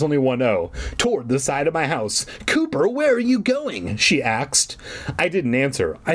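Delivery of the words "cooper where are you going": put-on high girl voice. Girl's line voiced high.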